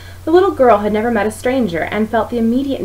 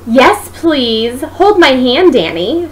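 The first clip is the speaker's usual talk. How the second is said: loud, pointed reply with a teasing edge; said loudly; 6 semitones higher, 5 dB louder, much slower than usual. A narrator's reading. Chris loudly answering Danny's teasing question, pointed and loud. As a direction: say it loudly so everyone hears. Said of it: "she said loudly".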